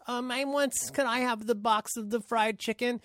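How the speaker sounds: timid customer. Retold or imitated. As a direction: meekly ask for the chicken. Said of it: "And somebody goes".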